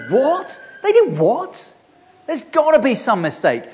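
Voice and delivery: high, rising cry of disbelief; the owner can't believe the news; shocked disbelief. Retold or imitated. Vineyard owner hearing his servant was beaten, shocked high disbelief.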